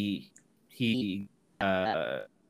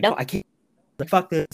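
raised, higher mock outburst; panicked refusal; 6 semitones higher, 8 dB louder than usual. Gowan backing out before the Green Knight, mock panic. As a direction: act out giving up in a panic.

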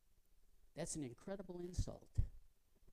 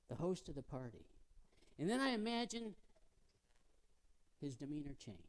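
normal talking voice

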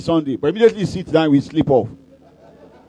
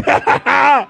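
higher, laughing yay; laughing cheer; 7 semitones higher than usual. Acted. Preacher cheering the baby's kick with a high laughing yay. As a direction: burst out in joy.

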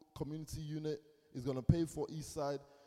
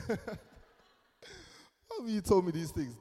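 sung out through laughter, slow; laughing reproach; 6 semitones higher, 6 dB louder, much slower than usual. Retold or imitated. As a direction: tease them half-singing while laughing. Laughing, sing-song reproach.

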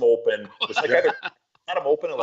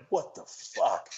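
dazed disbelief; 6 semitones higher, 7 dB quieter, much slower than usual. Retold or imitated. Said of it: "He's like".

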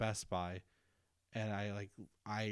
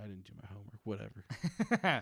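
mock teen attitude. Retelling his teen self to the teacher, dismissive. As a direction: shrug it off like a hard ass.

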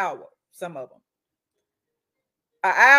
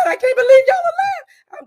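much higher and louder, breaking into a cry; a witness sobbing in disbelief; 9 dB louder than usual. Retold or imitated. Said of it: "shaking and crying".

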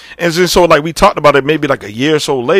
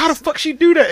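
amazed; 12 semitones higher than usual. Retold or imitated. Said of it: "I was like".

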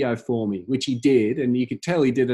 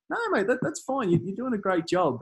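high, light mock of an easygoing star; reassuring Andrew Johns; 7 semitones higher than usual. League legend reassuring the nervous fan, light high mimic. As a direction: wave off the worry kindly.

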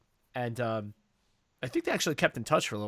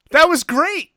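high, louder burst of delight; delighted dad; 13 dB louder, slower than usual. Retold or imitated. Dad praising the prank to Paul, high delighted exclaim. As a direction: cheer it out like the best joke ever. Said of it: "And my dad says".

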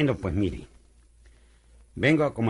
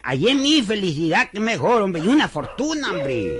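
excited; 8 semitones higher, 5 dB louder, slower than usual. Acted. Chico boasts of a fortune.

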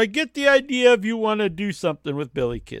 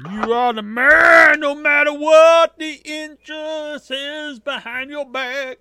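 shouted, raised and loud; loud hype shout; 5 semitones higher, 6 dB louder than usual. Real, not acted. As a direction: yell it out to hype your buddy. Hyping up a friend loudly.

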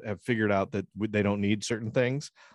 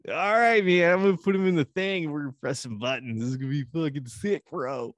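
bro voice; 6 semitones higher than usual. Retold or imitated. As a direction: hype it up like a dopey bro. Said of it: "they're like".

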